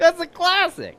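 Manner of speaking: high and slow, pleased with himself; delighted. Real, not acted. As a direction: savor the corny joke. Laughing at own joke.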